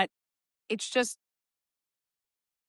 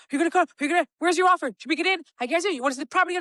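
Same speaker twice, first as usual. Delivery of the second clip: much higher, pushy, tumbling questions; mock pushy chaser; 8 semitones higher, 3 dB louder, much faster than usual. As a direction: badger the buyer, overeager. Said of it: "if you keep chasing the buyer".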